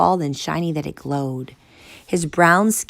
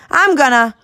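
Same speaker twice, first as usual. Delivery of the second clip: loud protest; 11 semitones higher, 10 dB louder than usual. Acted. Kid cut off.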